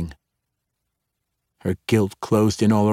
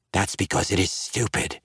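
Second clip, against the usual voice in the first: softer, rumbling, grating giant with a hiss; grinding, hissing monster voice, a bit softer; giant's voice; 6 dB quieter than usual. A narrator's reading. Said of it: "In the voice of a giant, rumbling and grating and hissing".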